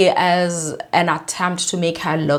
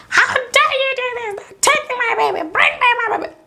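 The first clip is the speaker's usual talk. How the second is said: very high-pitched hammed-up wail; put-on, over-dramatic squealing lament; mock toddler wailing. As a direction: wail it like a tantruming toddler, mocking her. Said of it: "She is wailing. She's like".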